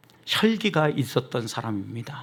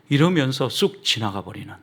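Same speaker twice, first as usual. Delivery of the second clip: usual speaking voice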